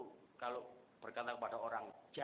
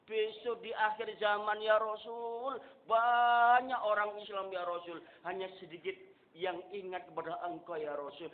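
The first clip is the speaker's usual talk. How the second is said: fervent preaching; 8 semitones higher, 7 dB louder than usual. Acted. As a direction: proclaim it to the congregation. Preaching at full force. (khotbah lantang dan pelan)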